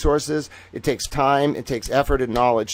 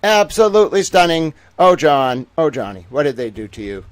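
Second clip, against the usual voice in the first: ordinary talk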